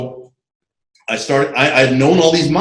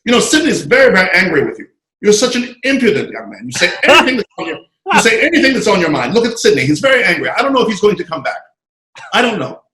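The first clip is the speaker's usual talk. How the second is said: higher, fussy Bahamian scolding; lightly done Bahamian accent, pitched up; 6 semitones higher than usual. Retold or imitated. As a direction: imitate the Bahamian aide chiding an impudent kid. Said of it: "this other guy from the Bahamas who would say".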